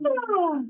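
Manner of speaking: high, loud freeing ah; high release ah. Shown as a demonstration. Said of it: "See how it feels to release".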